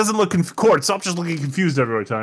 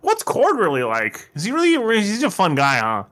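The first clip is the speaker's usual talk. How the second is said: mock interviewer; 7 semitones higher than usual. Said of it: "They're like".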